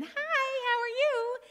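put-on high, loud sing-out in a small kid's voice; squeaky-high little voice, loud and friendly; little child's voice. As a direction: chirp it like a little kid calling from the next stall. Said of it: "the voice came again".

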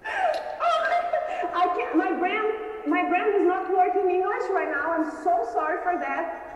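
high, loud, laughing disbelief; words tumbling out in happy shock; overwhelmed joy. Real, not acted. Winner reacting to her own win, overjoyed and laughing.

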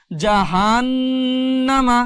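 জান্না with the ন্ন hummed long through the nose; held nasal ghunnah. Shown as a demonstration. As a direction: stretch the nasal hum in জান্না. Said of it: "গুনগুন আওয়াজ".

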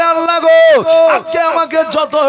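high, loud pleading cry; anguished cry to God. Acted. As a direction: call out to God in pain. Bilal cries out to Allah.

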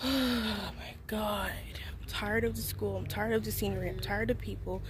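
long sighed start, then low and soft; drained, under-the-breath complaint; weary. Real, not acted. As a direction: list it quietly, worn down and fed up. Sighing, sick of school.

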